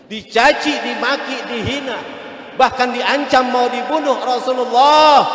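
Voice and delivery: rising to a forceful cry; loud preaching. Acted. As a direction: hammer each word harder. Insults and threats listed with force. (seruan keras berapi-api)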